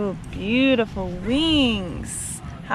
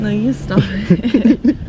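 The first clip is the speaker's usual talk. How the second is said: laughing; 2 semitones lower, slower than usual. Real, not acted. Laughing, teasing back.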